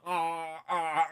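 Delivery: imitated open-mouthed "ah"; wordless noises as if the mouth is full; mouth held open. Patient in the dentist's chair, imitating open-mouthed noises. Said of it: "got his fist in your mouth".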